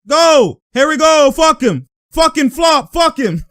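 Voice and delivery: high, loud mock trash talk; imitated shouting. Imitating Paul still talking at opponents, heated shouting. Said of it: "He still talks like".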